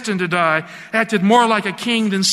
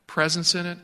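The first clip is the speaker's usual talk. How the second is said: ordinary delivery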